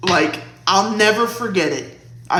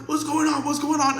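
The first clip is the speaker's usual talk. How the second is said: groggy husband; 8 semitones higher than usual. Retold or imitated. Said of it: "he had just woken up".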